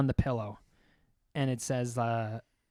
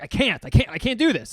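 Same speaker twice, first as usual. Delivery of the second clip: higher, louder protest; mock panic; 8 semitones higher, 7 dB louder than usual. Man resisting the pickle cutter urge, hammed-up panicky protest. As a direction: blurt it as if talking yourself out of it.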